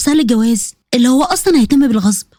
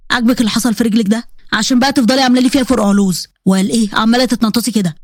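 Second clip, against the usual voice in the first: lowered man's voice, reproachful; scolding husband; 4 semitones lower than usual. Acted. Husband blaming his bride for her hurt leg, scolding. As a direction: chide her in a low, stern voice.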